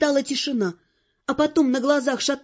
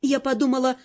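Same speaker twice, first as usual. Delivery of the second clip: ordinary delivery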